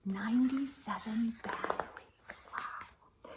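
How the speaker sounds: awed hush; whisper. Real, not acted. Reader marvelling to the children in a whisper. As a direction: breathe it in wonder.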